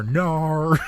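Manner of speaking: "no" drawn out in an Australian accent; exaggerated Australian vowel on "no". Shown as a demonstration. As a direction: say "no" as a stretched Aussie "naur". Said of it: "more specifically, tell me".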